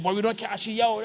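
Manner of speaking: scolding bystander. Retold or imitated. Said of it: "and he said".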